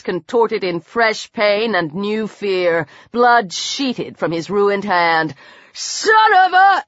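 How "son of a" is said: high, drawn-out enraged cry; furious curse. A narrator's reading. Attacker unable to yank her hoe free, furious. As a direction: curse slowly and much higher, in rage.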